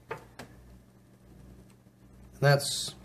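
ordinary delivery

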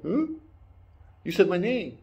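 high, questioning little-creature voice; child's voice. Retold or imitated. Child hears his name from Mando, high and questioning. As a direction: pitch it up like a puzzled child. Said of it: "The child goes".

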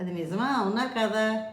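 usual speaking voice

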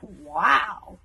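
awed exclamation, drawn out; gasped wow. A narrator's reading. Stoic watching the axe trick, gasping in awe. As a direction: let out an impressed gasp of a word. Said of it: "gasped Stoic".

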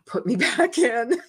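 bright laughing repeat of put me back in. Real, not acted. Repeating her choice to the archangel, laughing.

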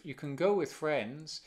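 "go" carries the stress with a rise. Shown as a demonstration. Teacher showing students where the stress falls, stress on go. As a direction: lean on the verb "go". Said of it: "pero la entonación es go".

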